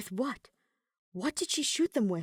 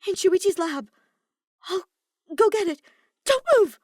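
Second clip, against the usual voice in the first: high, loud, stammering panic; frantic, yelling through fear; panicked shouting; 13 semitones higher, 9 dB louder than usual. A narrator's reading. Maki panics over poisoned Kaito.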